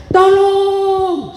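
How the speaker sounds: a high, loud cry of "Tolong"; retold shout for help. Injured man retelling his cry for help, a high loud shout. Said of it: "Lalu saya berteriak".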